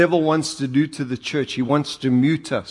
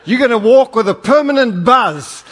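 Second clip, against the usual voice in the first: laughing loud joke; 10 semitones higher, 7 dB louder than usual. Acted. Joking threat, loud with laugh.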